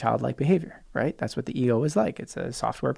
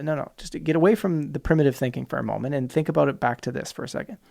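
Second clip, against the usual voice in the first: normal talking voice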